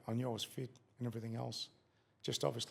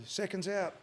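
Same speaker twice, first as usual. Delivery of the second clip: lightly voiced ring announcer over the PA, a bit higher and louder; ring announcer call; 6 semitones higher, 3 dB louder, slower than usual. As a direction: call it out like the PA at a fight. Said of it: "the P A".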